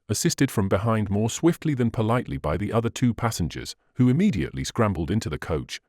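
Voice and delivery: ordinary delivery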